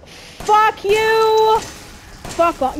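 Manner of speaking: loud, high yelled insult; angry shout. Real, not acted. Player yelling at the villain's taunt, angry shout. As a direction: hurl it at the villain.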